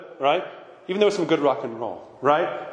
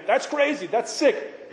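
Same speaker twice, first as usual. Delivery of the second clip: higher-pitched imitation of grandma disapproving; mock grandmotherly disgust; scolding grandma; 6 semitones higher, slower than usual. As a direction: mimic a scandalized grandmother. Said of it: "at least grandma was always saying".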